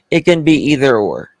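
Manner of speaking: ordinary talk, nothing marked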